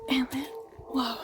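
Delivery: hushed whisper. Real, not acted. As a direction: whisper so no one wakes. Sneaking near sleeping family.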